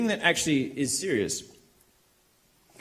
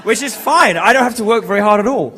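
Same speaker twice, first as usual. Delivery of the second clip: raised voice over a cheering room; loud exclamation; 5 semitones higher, 13 dB louder than usual. Real, not acted. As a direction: project big and high to the whole hall.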